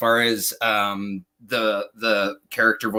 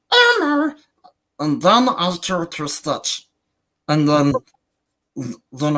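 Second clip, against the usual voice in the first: cartoon voice; 3 semitones higher than usual. Shown as a demonstration. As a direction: pop up into a cartoon voice. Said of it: "I can go from good old Captain Jack".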